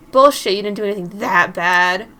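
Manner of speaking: loud scoff, throwing the words back; angry retort. Acted. Friend rejecting the prankster's excuse, loud and scornful. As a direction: fire it back loudly in disbelief.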